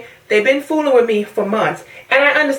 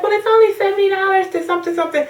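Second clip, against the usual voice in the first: mock clerk voice; 8 semitones higher, faster than usual. Clerk quoted pitching upgrade.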